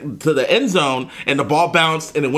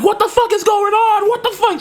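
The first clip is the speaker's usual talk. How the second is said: mock outraged shout. Texting a friend during the game, high outraged shout. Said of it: "texting her, like".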